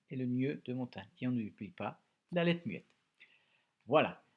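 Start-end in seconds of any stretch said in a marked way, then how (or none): none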